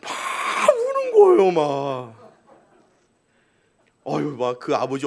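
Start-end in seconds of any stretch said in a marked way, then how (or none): 0.0-1.5 drawn-out high wail, slow; mock crying. Imitating the child crying. (아이 울음 흉내)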